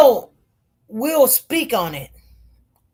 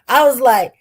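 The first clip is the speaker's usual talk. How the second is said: ordinary talk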